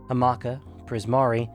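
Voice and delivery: ordinary delivery, nothing marked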